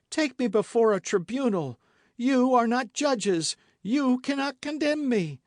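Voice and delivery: high, loud desperate shriek. A narrator's reading. Milady shrieking at her accusers. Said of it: "shrieked Milady".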